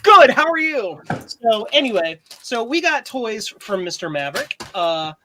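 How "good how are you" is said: higher and louder, a bright greeting back; mock cheerful retold reply. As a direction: answer the Aussie greeting with enthusiasm. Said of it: "I'm like".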